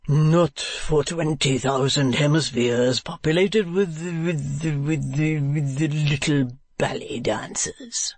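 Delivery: slow, drawn-out poetic gushing; poetical strain. A narrator's reading. Husband flattering his wife, slow poetical strain. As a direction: gush slowly, stumbling over the flourish. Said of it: "in a poetical strain".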